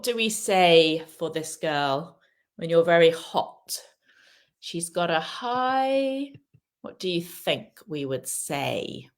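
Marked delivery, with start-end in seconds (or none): 4.6-6.3 high, drawn-out hanging intonation; rising, held-open pause. Shown as a demonstration. Teacher leaving the sentence open for students, drawn out. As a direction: let "high" hang in the air, slow and rising. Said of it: "think about how you say it as well".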